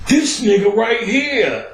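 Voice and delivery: slow, high, disbelieving drawl; exasperated drawl. Retold or imitated. Guard spotting Ellis again, slow exasperated drawl. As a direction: stretch it out, fed up.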